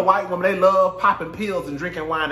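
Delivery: usual speaking voice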